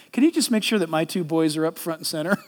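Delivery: low, quick, coaxing mom. Retold or imitated. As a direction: play the doting mother softly. Mom's request retold.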